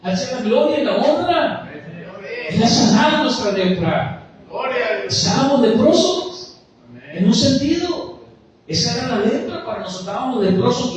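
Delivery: loud, climbing sermon proclamation; full-force preaching. Acted. Preacher proclaiming redemption to the congregation, loud and fervent. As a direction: preach it out with rising fire.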